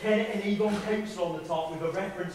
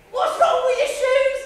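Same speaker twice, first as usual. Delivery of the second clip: mock high voice; 8 dB louder than usual. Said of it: "So I say".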